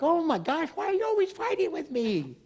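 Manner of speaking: high, whiny mock complaint with a laugh; a hammed-up whine; whiny mock complainer. Mimicking a quarrelsome person.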